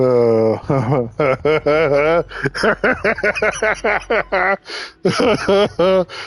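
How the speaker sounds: breathless after a laughing fit; real laughter spilling through the words; wheezing from laughing; laughing hard. Real, not acted. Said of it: "I'm laughing so hard".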